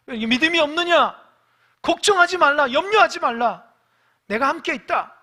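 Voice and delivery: high, loud stern rebuke; Jesus rebuking the frightened disciples. Retold or imitated. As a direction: scold their lack of faith. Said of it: "그랬더니".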